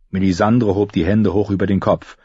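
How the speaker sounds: ordinary delivery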